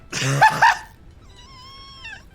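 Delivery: very high, loud shriek of laughter. Real, not acted.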